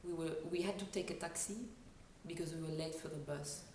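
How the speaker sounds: brisk French-accented sentence, stress falling at phrase ends; light, forward French accent. Shown as a demonstration. Coach repeating her practice sentence in a French accent. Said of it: "My sentence goes like this".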